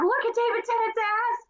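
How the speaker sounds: high-pitched urgent gushing; excited friend imitation. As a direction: imitate a friend urging you to look.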